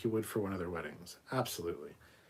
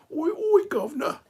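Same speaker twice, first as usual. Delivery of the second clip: very high, loud squeaky puppet; 11 dB louder than usual. Retold or imitated. Host playing Captain Foley's puppet, high and hammed-up. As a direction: squawk it out as a cheeky British puppet. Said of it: "his puppet and be like".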